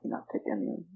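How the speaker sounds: whispering. Real, not acted. Unvoiced aside.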